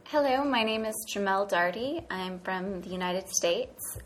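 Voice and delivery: ordinary delivery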